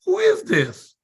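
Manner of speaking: high-pitched baffled "who is this?"; startled reply. Retold or imitated. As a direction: act out a bewildered listener on the phone. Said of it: "My mastermind is going".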